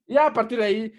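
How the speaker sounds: ordinary talk, nothing marked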